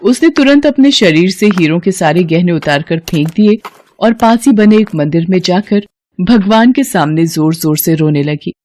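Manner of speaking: plain reading voice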